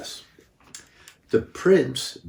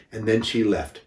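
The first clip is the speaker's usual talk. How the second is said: ordinary delivery, nothing marked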